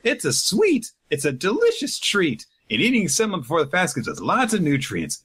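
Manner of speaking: put-on girl explains the treat, high and eager; little girl. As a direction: chirp it like an eager kid. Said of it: "the little girl with her is like".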